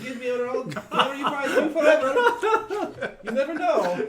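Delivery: loud, high, laughing through the words; helpless high-pitched laughing delivery. Real, not acted. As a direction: crack up while saying it. Joking amid table laughter.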